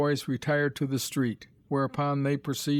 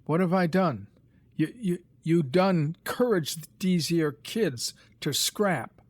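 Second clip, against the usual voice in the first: self-righteous higher dialect voice; virtuous; 5 semitones higher, 3 dB louder than usual. A narrator's reading. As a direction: accuse him with pious righteousness. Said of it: "said Peter virtuously".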